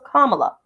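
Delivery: Kamala as comma-la, first syllable stressed; correct pronunciation. Shown as a demonstration. Explaining to viewers: Kamala as comma-la. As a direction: land it plainly: Kamala as comma-la. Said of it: "you say comma and you put la".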